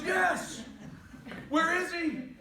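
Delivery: high, loud, panicked outburst; frantic dad shout. Retold or imitated. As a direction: shout it like a desperate father, acted. Frantic dad answers passing lady.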